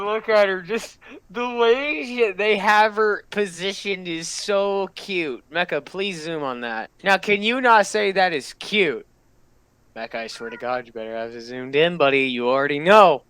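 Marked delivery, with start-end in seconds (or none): none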